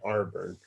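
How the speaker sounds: "Arburn" with "Ar" up front; local pronunciation. Shown as a demonstration. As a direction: relish the local "Arburn", R and all. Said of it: "also say Arburn".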